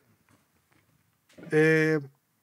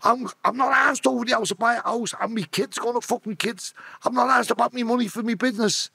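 higher-pitched table-banging rant; mock angry client; 6 semitones higher than usual. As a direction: imitate the client pounding the table as he insists. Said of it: "And he's banging on my table".

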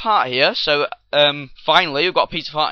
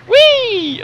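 high gleeful whee; excited; 9 dB louder than usual. Real, not acted. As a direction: squeal it with glee. Boat launches off spume.

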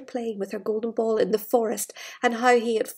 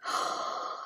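sharp unvoiced intake of breath; a mock-shocked gasp; breathy gasp; 12 dB quieter than usual. Reading aloud to a child, a hushed theatrical gasp. Dramatic gasp during the story.

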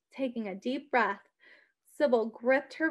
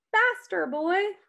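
urging; 7 semitones higher, 4 dB louder than usual. A narrator's reading. Urging horse on.

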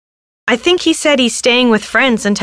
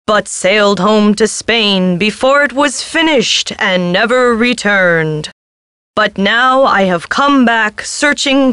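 very slow, drawn-out spectral lament; mournful ghost; slower than usual. Acted. Ghost searches for his lost wife, mournfully. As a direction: stretch each vowel like a haunting spirit.

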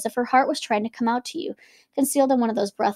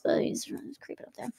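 whispered aside; soft conspiratorial whisper; 4 semitones higher, 11 dB quieter than usual. Real, not acted.

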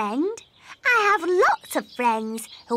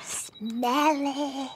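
hushed secret whisper; 2 semitones lower, 6 dB quieter than usual. Acted. Princess telling a secret about her friend, whispered.

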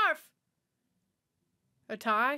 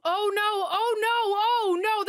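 alarmed oh no; 9 semitones higher, 7 dB louder, slower than usual. Real, not acted. Alarmed reaction to movie scene.

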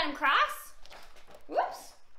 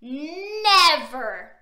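loud, long-drawn troll refusal; refusing troll; 8 dB louder than usual. A narrator's reading. Troll refusing Middle Billy, booming.

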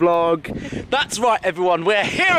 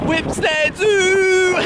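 excited; 13 semitones higher, 3 dB louder, much slower than usual. Real, not acted. Arriving at the zoo, excited.

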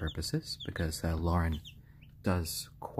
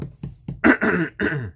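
loud rough throat clear; 10 semitones higher, 8 dB louder than usual. Real, not acted. Keeper clearing a hoarse throat on stream, loud throat clear. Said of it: "sorry, my voice is getting a little bit hoarse".

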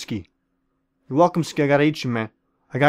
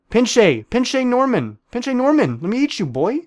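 hammed-up Spanish-flavoured threat, higher; mock Mexican taunt; 7 semitones higher than usual. Gamer taunts a player named Norman in a hammed-up Mexican-flavoured voice. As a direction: bark it like a cartoon bandit.

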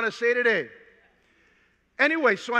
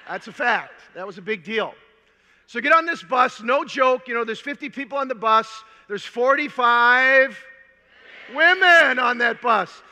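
usual speaking voice

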